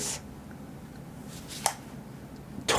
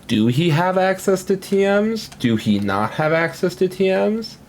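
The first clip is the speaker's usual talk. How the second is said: mock dumb question; 8 semitones higher than usual. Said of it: "So it's like".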